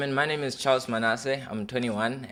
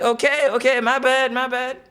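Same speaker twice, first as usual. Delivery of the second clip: high apology; 12 semitones higher, 5 dB louder than usual. Retold or imitated. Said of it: "And I'm like".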